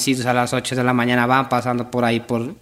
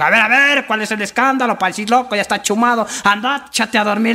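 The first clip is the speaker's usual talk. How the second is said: high-pitched, bossy officer breaking up a racket; policeman; 7 semitones higher than usual. Acted. Policeman tells a shouting drunk to go sleep, bossy. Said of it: "llegó un policía y le dijo".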